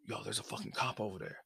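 warning the delivery person about the cop, quietly; hushed warning. Retold or imitated. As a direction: tip them off under your breath.

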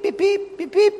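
high squeaky beep beep imitating the scanner; mock till beeps. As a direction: chirp like a checkout scanner. Mimics the cashier's till beeping.

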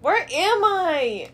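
loud, high, stretched-out cry; lost, exasperated wail. Real, not acted. Player lost among rooms, exasperated wail. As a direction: wail it slowly in helpless confusion.

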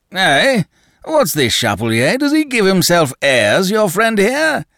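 high, loud indignant bluster; blustering Danton. A narrator's reading. Danton demands an answer from Le Chapelier, blustering. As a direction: bark it at the companion.

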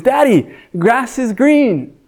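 put-on a small kid telling his dad; child's voice. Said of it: "and he says".

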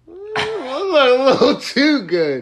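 high, drawn-out appreciative hum into a laugh; admiring then jealous. Retold or imitated. Boyfriend eyeing his girlfriend's outfit, admiring then laughing. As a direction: savor it, then catch yourself with a chuckle. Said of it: "I'm like".

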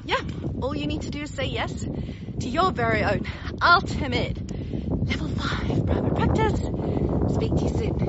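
loud, high enthusiastic sign-off; excited. Real, not acted. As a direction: sell it with big energy.

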